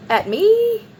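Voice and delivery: shocked mock indignation; incredulous. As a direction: pitch jumps way up in disbelief. Outraged quote to roommate.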